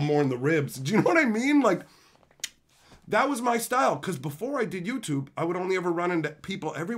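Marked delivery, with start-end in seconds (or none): none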